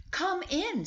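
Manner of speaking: high, bright welcoming call to the door. Acted. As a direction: sing out the welcome like a cheerful mother.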